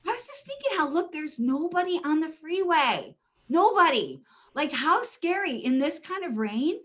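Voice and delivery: high, eager chatter; cheerfully talking up the bright side; bubbly explanation. Retold or imitated. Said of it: "I was like".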